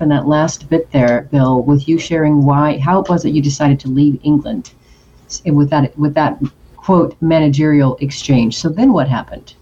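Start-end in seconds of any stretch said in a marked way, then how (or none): none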